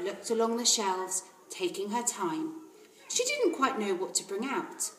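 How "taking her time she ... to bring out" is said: ordinary delivery, nothing marked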